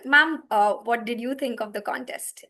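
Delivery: normal talking voice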